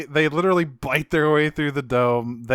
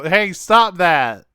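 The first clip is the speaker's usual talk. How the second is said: loud, slow "Hey, stop that!"; shouted order; 4 semitones higher, 8 dB louder, much slower than usual. Retold or imitated. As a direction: call out to stop them. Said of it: "run in and go".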